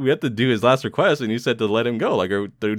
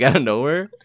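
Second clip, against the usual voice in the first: usual speaking voice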